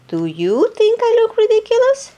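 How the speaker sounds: high, hopeful hippo voice. Acted. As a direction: ask it as the anxious hippo. Hippo asks each animal.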